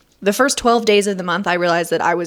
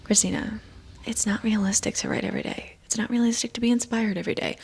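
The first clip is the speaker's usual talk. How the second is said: softer, quietly voiced objection; imagined viewer; 2 semitones higher, 7 dB quieter than usual. Viewer gently objecting to the writer, soft quoted voice. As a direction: voice the doubter in an undertone. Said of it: "you might be thinking".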